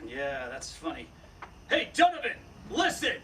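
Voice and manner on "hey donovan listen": a loud hail across distance; calling out loud. Acted. Marshal hailing the gunman up the rocks, loud call. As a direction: shout up the pass to the outlaw.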